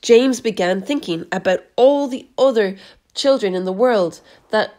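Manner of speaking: usual speaking voice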